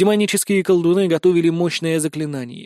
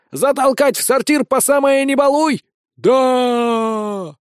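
high, raised yelling from the crowd; frenzied mob; 8 semitones higher, 3 dB louder, slower than usual. A narrator's reading. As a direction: egg the lynch mob on. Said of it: "неистовствовали крестьяне-пахари". (исступлённые выкрики толпы)

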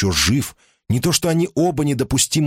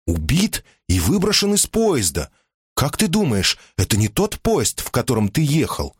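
higher, agitated, urgent; alarmed wife; 6 semitones higher than usual. Acted. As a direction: read out the news with alarm. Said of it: "сказала Симона".

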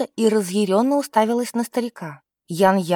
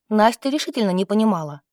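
plain reading voice

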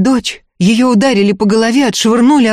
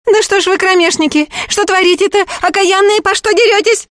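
shocked rider; 12 semitones higher than usual. Acted. A rider to the fighting women, very high alarmed shout. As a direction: stop the brawl with a shout.

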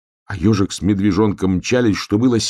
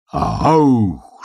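higher, drawn-out booming "akh"; thunder gasp; 5 semitones higher, 4 dB louder than usual. A narrator's reading. The sky booms over the runners, thunder gasp. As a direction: let the gasp swell and fall like thunder. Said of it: "снова ахнуло небо".

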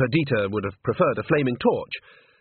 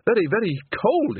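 high, emphatic insistence; raised pitch, hanging on; straining for composure; 10 semitones higher than usual. A narrator's reading. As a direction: insist desperately on the cold. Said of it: "Bucket managed".

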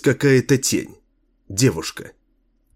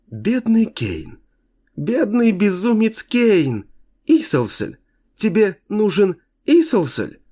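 cartoon princess; 11 semitones higher than usual. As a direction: pity him in a ringing girlish voice. The girl mocks Kane.

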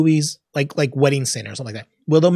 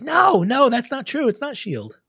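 protesting objection; 9 semitones higher, slower than usual. Retold or imitated. Fan objecting to a film's continuity error, retold protest. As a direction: object in a raised, disbelieving pitch. Retelling own objection to the film.

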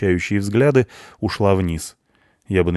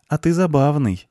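high, lightly amused blonde voice; friendly amusement; 9 semitones higher than usual. A narrator's reading. Blonde finding the punk funny, friendly amusement. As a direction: say ЗАБАВНЫЙ as a light, high compliment.

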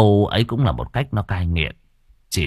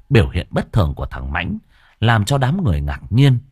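plain reading voice

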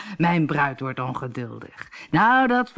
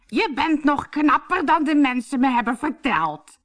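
high, put-on hoarse lady voice; 10 semitones higher, 3 dB louder than usual. A narrator's reading. Harlekijn in disguise greets the captain, hoarse voice. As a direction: disguise yourself as a hoarse bride. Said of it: "met een schorre stem".

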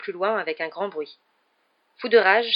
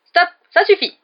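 high, loud cry to halt; shouted order; 12 semitones higher, 12 dB louder than usual. A narrator's reading. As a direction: shout to stop the fight at once. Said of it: "cria Lockhart". (ordre crié, aigu et fort)

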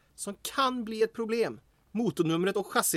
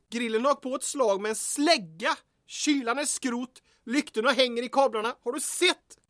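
usual speaking voice

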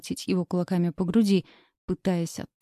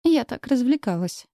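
higher, smirking, cheeky reply; insolent smirk; 5 semitones higher than usual. A narrator's reading. Girl hides fear from James, insolent smirking tone. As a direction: toss it off with a smirk, hiding fear. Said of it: "Нагло усмехнулась".

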